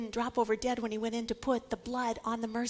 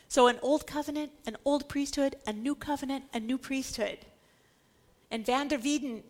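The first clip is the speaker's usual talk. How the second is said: ordinary talk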